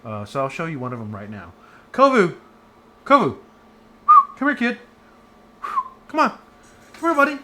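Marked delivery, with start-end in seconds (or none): 1.9-3.4 loud high call across the house; calling the dog. Real, not acted. Teacher calling her dog Kovu to come. 4.4-7.3 high loud coaxing call; calling the dog. Real, not acted. Teacher coaxing her dog to come over.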